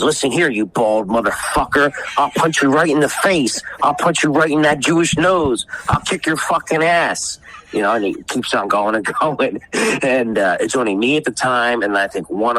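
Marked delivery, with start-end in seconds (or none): 0.0-7.4 put-on drunk threats. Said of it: "And he says".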